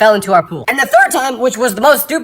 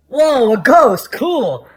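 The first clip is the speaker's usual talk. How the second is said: unimpressed; 5 semitones higher, 4 dB louder, much slower than usual. Acted. Person unimpressed by ghost.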